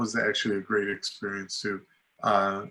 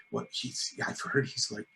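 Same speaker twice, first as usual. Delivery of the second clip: hushed warning; 2 semitones lower, 12 dB quieter than usual. Retold or imitated. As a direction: lean in and whisper the warning like a secret. Coworker warning him about Eric.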